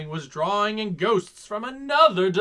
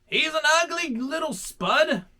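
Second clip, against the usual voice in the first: character voice; 9 semitones higher than usual. Acted. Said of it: "Ray spotted something".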